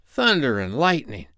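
lightly voiced grumbling pirate; muttered curse. A narrator's reading. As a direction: grumble the oath in a put-on voice. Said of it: "Ben Ali muttered".

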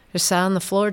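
normal talking voice

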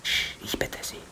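sharp hush then whispered plea; urgent hush. Acted. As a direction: shush her urgently.

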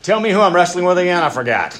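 loud, high, fast mock bewilderment; a hammed-up confused voice of God; mock forgetful God. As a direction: play God as a clueless detective for laughs. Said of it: "It's not like God's like".

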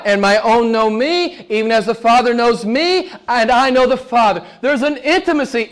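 sermon rising in pitch toward the end; building preaching. Real, not acted. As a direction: build from emphatic teaching to high proclamation.

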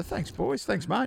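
put-on high, soft, light thanks to the coffee boys; high, soft, friendly thanks to the barista boys; casual thanks. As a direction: lightly sing it out. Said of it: "and I say".

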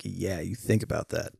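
low, soft mock warning; quiet, knowing comeback; mock threat. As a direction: drop the voice for a joking threat. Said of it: "And people would be like".

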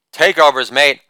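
usual speaking voice

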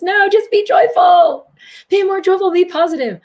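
very high, loud sing-song mockery; mock forced positivity. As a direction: parody a relentlessly upbeat cheerleader. Mocking forced positivity as false road.

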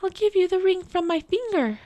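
put-on high, light girl's voice. A narrator's reading. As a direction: voice the girl, pitched well up. Girl answers in the fairy tale.

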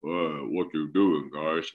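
low, slowed-down TV voice; dark distorted voice. Retold or imitated. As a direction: drag the words out deep and slow. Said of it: "då är det samma sak där. Han säger typ så här".

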